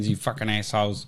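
ordinary talk

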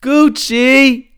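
very high, loud cries of the name; amused holler. Real, not acted. Host in stitches over the rapper's antics, amused holler. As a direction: wail the name, worn out with laughing.

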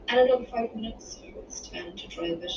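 ordinary delivery, nothing marked

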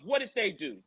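ordinary talk

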